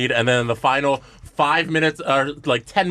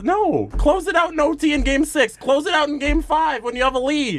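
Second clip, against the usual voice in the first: high-pitched, exasperated arguing; heated rebuttal; 11 semitones higher than usual. Real, not acted. Arguing the team should have closed the series, exasperated. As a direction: hammer the point home, pitched way up.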